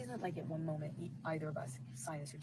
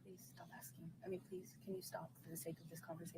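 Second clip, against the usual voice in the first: breathy near-whisper, barely voiced; a real, quiet plea to stop; hushed plea; 14 dB quieter than usual. Real, not acted. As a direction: plead under your breath. Pleading mid-argument, very hushed.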